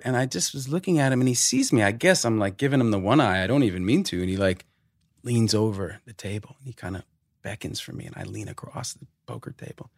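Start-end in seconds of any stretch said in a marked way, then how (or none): none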